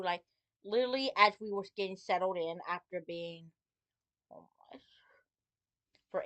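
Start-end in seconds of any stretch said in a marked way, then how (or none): 4.3-4.8 whispered word, dropping away softly. Real, not acted. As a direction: whisper it, hushed. Sensitive word in sad story.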